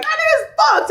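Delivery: very high, loud venting cry; retold outburst. Woman retells calling her best friend about the cheater, worked up. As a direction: blurt it out in a high-pitched outburst.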